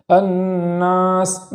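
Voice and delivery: الناس slow, nasal hum held on ن; nasal ghunna on noon. Shown as a demonstration. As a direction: hum the ن of الناس one count. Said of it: "الف کے اندر گنہ کی آواز نہ چلی جائے".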